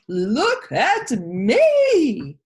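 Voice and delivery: loud, very slow, stretched yell. Acted. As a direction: yell it, stretching every word. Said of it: "Pig pushed right past him and yelled".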